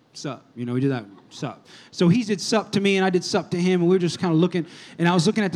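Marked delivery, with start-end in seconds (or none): 0.1-0.4 low, soft mumbled "Sup?"; a cool, lowkey guy-to-guy greeting; guy nod greeting. Retold or imitated. Guy greets another guy in the museum, low and cool. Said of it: "We just do the guy thing". 1.3-1.7 low, soft mumbled "Sup?"; a cool, lowkey guy-to-guy greeting; guy nod greeting. Retold or imitated. Guy greets another guy in the museum, low and cool. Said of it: "We just do the guy thing".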